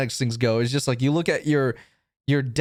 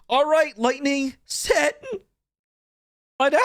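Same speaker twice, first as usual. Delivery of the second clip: much higher, slow, imitating a younger self; mock high-pitched boy giving dog commands; light teenage voice; 10 semitones higher than usual. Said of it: "my voice was light as fuck".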